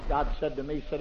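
ordinary talk

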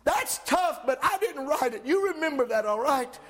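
high, ringing pulpit voice, climbing to a shout; fervent preacher lifting the room; full-force preaching. Acted. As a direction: preach it out to the whole church. Preacher quoting Paul.

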